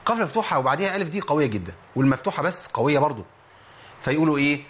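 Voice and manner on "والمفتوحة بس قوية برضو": normal talking voice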